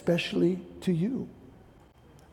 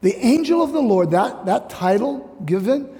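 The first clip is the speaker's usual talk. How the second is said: normal talking voice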